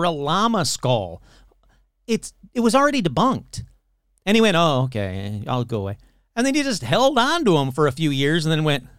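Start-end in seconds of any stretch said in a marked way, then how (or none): 4.6-6.0 low, deflated put-on voice; mock meek resignation. Imitating the debunked hoaxer backing off, low mock resignation. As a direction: give up meekly in a low voice.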